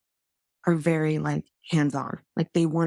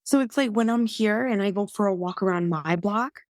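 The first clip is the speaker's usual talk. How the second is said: ordinary talk, nothing marked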